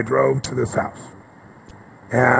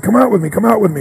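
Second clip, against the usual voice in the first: high, loud beckoning; raised voice rallying little boys to follow; excited calling out; 8 semitones higher, 5 dB louder, faster than usual. Retold or imitated. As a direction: call the kids out eagerly, acted.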